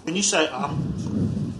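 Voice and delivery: talking while chewing; words through a mouthful; mouth full. Real, not acted. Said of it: "never talk with your mouth full".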